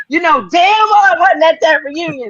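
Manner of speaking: loud and high, playfully indignant; mock outraged shout. Real, not acted. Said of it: "you wanna hear my response".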